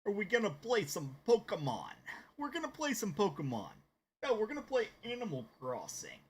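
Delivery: put-on celebrity voice, pitched well up; Patrick Warburton. Streamer announces the game to viewers as Patrick Warburton, celebrity impression. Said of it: "I'm gonna talk like Patrick Warburton".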